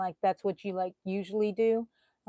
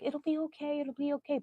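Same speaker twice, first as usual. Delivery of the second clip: higher, softer, a light sing-song brush-off; hollow reassurance, said higher and softer; mock platitude; 7 semitones higher, 6 dB quieter than usual. Shown as a demonstration. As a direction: toss off the empty comfort lightly. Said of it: "silly little words".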